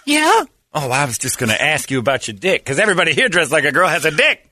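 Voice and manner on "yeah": put-on high girly yeah. Answering in put-on girl voice.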